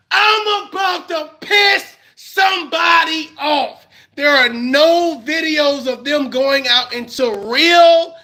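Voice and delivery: furious rant at online beefers; heated shout. Real, not acted. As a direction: yell it at the audience, fed up. Heated rant, yelling at the audience.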